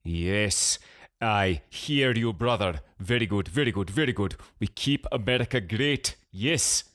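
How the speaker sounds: thick, put-on Pakistani accent; cartoonish Pakistani accent. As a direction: ham up a Pakistani accent to prank someone. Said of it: "He spoke in a thick, cartoonish Pakistani accent".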